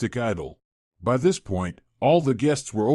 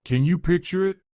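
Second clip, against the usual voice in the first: plain reading voice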